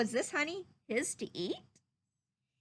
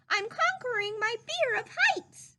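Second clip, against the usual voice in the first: high, small squeaky voice calling from a tree; a tiny piglet shouting down bravely; 10 semitones higher, 3 dB louder than usual. Acted. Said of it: "Piglet called".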